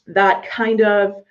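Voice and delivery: usual speaking voice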